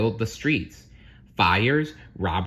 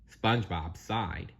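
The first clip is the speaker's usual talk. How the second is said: ordinary delivery, nothing marked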